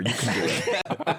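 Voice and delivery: slow, low, laughing pep talk; mock coach. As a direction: cheer them on like a gym coach.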